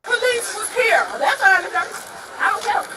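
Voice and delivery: real frustrated yell; heated shout. Real, not acted. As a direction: raise the voice to make the point. Arguing at crash scene.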